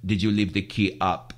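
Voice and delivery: put-on low, easy Greek accent question; calm question. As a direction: ask it casually, no anger yet. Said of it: "With the cigarette".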